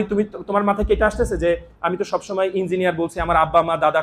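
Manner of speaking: ordinary talk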